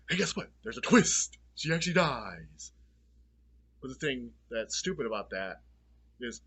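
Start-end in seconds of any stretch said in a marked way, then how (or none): 0.1-2.7 mock announcer. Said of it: "They're like".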